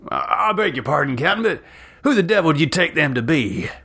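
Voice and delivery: high, fast, flustered; agitated question. A narrator's reading. Yancey asking the captain, fast and agitated. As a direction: rush it out nervously.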